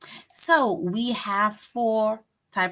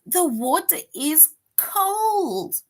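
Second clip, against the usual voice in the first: much higher and very slow, sing-song; expressive read; 10 semitones higher, 4 dB louder, much slower than usual. Shown as a demonstration. Teacher reading the last sentence option, high and slow. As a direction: stretch it out like telling a child. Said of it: "And option four says".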